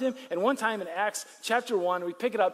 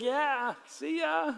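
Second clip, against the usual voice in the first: a light, high, casual farewell; breezy goodbye; 8 semitones higher, much slower than usual. Retold or imitated. Jesus waving off Peter as he rises, mock-casual. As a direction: toss off a mock-casual see ya. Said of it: "He's like".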